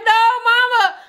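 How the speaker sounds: high whimpering no mama; mock crying plea. Tammy begging her mother, a mock cry. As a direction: whine it up high like a crying child. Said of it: "she like".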